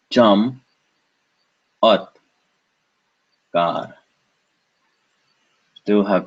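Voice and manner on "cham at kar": "cham, at, kar" with a long gap after each; alternative syllable split. Shown as a demonstration. Teacher showing another way, "cham, at, kar" syllable by syllable. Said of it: "we could have broken it this way".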